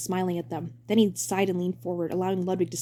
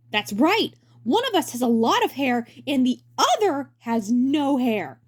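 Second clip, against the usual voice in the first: hammy stage actor; 6 semitones higher, 5 dB louder, slower than usual. Acted. Presenter voice.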